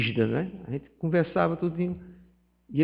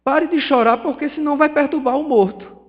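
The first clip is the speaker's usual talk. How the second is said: loud, higher repeat of the rebuke; retold stern rebuke, higher and louder; scolding shout; 8 semitones higher, 5 dB louder than usual. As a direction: insist over the mourners' crying. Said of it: "Aí ele chegou no meio do velório e disse".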